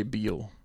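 imitating her "Beal" for bill; wife's pronunciation. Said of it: "she'd say Beal".

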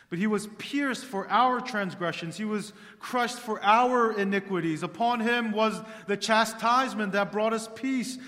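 thundering pulpit reading; full-force preaching. Acted. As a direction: preach Isaiah out to the whole hall.

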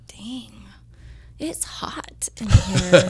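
drawn-out whisper of dismay; whispered reaction. Retold or imitated. Newcomer walks into a hot studio, whispering in dismay. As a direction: whisper it slowly, as if to yourself.

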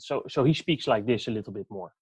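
imitating a Dutch accent in English; put-on Dutch-accented English. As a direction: mimic a Dutchman's English with slushy s sounds. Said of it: "he's got the Dutch accent".

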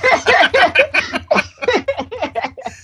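a high, loud, open laugh; big laugh. Real, not acted. Guest laughing at his own tease about the late hour. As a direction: let the laugh burst out high.

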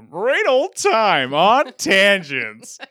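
big, drawn-out, high and loud show plug; radio host voice. Pretend. Host plugging his own podcast to listeners, hammed-up radio voice. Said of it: "Is that your radio voice?".

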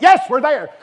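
high, loud burst of mock eagerness; eager flesh. Preacher voices his flesh accepting her dinner invitation, excited shout. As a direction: shout it like temptation cheering you on. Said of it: "my flesh was like".

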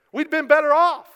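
loud, high protest; complaining. Acted. Preacher voices Israelites criticizing God, loud complaining. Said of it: "they're complaining, they're criticizing God".

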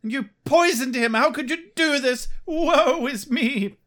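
loud, high, melodramatic outrage; hammed-up affronted wailing; mock indignant mom. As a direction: scold like a wronged mother in a melodrama.

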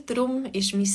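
ordinary delivery

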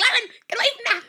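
high, loud mock shout; hammed-up panicked warning, half laughing; urgent warning. Retelling a warning shouted to Lauren, frantic.

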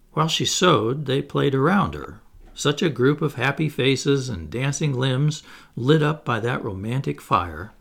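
ordinary delivery, nothing marked

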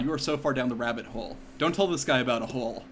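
normal talking voice

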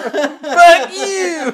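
loud laughing yell. Retold or imitated. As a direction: shout it across the yard.

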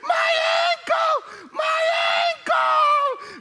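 high, loud howl of pain; hammed-up cry of pain; mock pained shout. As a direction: wail it like a hurt player. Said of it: "he's on the ground shouting".

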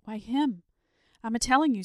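exasperated, emphatic shepherd; agitated insistence. A narrator's reading. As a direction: insist on it, all worked up. Frightened shepherd insists to wife.

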